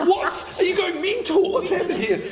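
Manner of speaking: high-pitched mock disbelief, laughing; hammed-up, very high incredulous voice; incredulous outburst. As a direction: squeal it out in comic alarm. Acted outburst mid-speech, laughing.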